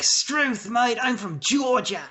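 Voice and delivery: high, slow hammed-up Australian drawl; mock Australian. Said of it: "we were all like".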